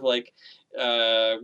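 ordinary talk, nothing marked

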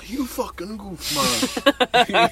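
hammed-up street yell; imitating a yell. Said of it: "He just starts yelling".

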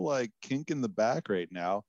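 ordinary talk

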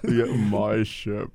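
slow, drawn-out hero impression; Thor voice. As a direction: stretch it out like a pompous superhero. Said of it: "it was his Thor voice".

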